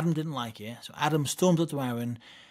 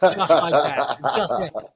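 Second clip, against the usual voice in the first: laughing; 2 semitones higher, 7 dB louder, slower than usual. Real, not acted. Speaker confirming his impression to the amused host, laughing. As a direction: answer through real laughter.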